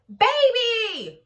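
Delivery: put-on loud, high call for Baby; shouting. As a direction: shout the name across the yard. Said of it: "she shouted".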